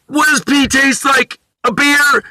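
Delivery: put-on high, slow childish question; a rich guy asking like a small child; little kid voice. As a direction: ask it like a wide-eyed little kid. Tony's little-kid voice continues.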